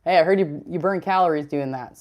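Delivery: ordinary talk, nothing marked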